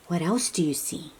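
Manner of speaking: ordinary delivery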